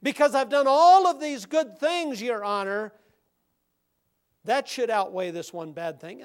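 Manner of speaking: high insistent self-justifying plea; pleading criminal. Retold or imitated. Criminal bargains with the judge, insistent and high. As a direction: insist your goodness outweighs the crime. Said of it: "this individual said".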